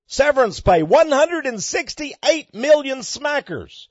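incredulous preacher's outburst; mock exclaim. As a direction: hammer each word of "Severance pay" like a punchline. Mock outrage at CEO payout.

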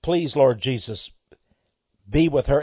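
soft, low retold prayer; hushed plea to Jesus; quiet prayer. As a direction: pray gently over her. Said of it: "and I said".

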